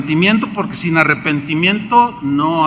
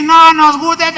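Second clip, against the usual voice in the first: mocking townsfolk; 11 semitones higher, 5 dB louder than usual. People mock the prophet's message.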